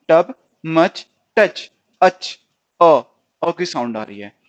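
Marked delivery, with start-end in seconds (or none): 0.1-2.4 टब and टच said loud and clipped, short अ sound; loud sound demo. Shown as a demonstration. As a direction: bark out टब, मच, टच for the class. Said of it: "देखो same same sound आ रही है ना".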